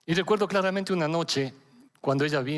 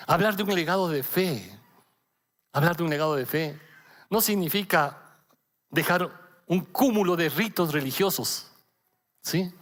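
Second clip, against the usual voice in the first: ordinary talk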